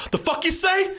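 high-pitched, escalating angry shouting impression; snapping in rage. Mother threatening in the car, acted snapping in rage. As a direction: imitate a mother flying off the handle. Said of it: "her snapping like a wild animal".

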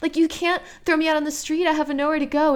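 put-on milk the self-pity like a drunk Southern aunt; dramatic Southern aunt. Girlfriend thrown out by her boyfriend, pleading in hammed-up self-pity. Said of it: "like, Tennessee Williams ... dramatic, drunk Southern aunt".

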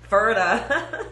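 loud laughing shout of Ferda; excited catchphrase. Retold or imitated. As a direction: blurt it out with a laugh.

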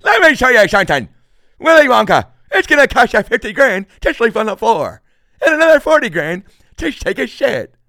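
high, loud manic warning voice; Fire Marshal Bill impression. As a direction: bark prices like a crazed safety inspector. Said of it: "Fire Marshal Bill from In Living Color".

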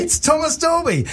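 high, breathless fanboy awe; starstruck gasp. Retold or imitated. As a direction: say his name like you can't believe he's there. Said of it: "I was a terrible fanboy".

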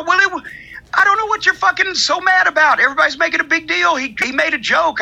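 high, dismissive voice of online fans; mocking defenders. Imitating fans telling critics to calm down, high and dismissive. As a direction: toss it off high like an eye-rolling fan. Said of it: "other people go".

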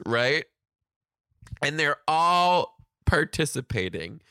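normal talking voice

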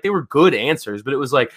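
ordinary talk

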